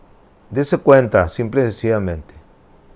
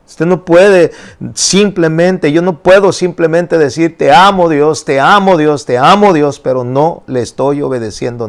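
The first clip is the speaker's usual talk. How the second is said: higher, louder preaching in rolling lists; prédica enérgica; 6 semitones higher, 6 dB louder than usual. Acted. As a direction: preach it out with rising force. Preaches at full force. (predicación con fuerza, voz alta)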